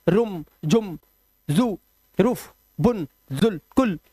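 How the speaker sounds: रुम, जुम, बुन, कुल each chopped to a short u; रुम, बुन, कुल misread short, word by word; wrong short vowels. Shown as a demonstration. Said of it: "ये मत पढ़ना".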